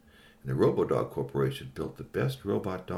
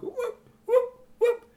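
robot dog yelp; 5 dB louder, much slower than usual. A narrator's reading.

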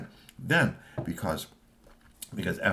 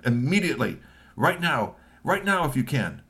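urgent boss; 6 semitones higher, 3 dB louder than usual. Acted. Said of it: "Kusamono-san".

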